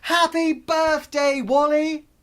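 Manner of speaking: happy crowd shouting greetings. Acted. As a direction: cheer like a whole crowd. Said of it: "five hundred people shouted".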